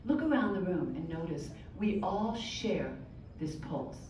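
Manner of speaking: soft, low-volume guiding voice; gentle guided listening. Shown as a demonstration. Teacher guides the group to find their heartbeat, soft guidance. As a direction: quietly draw the group inward.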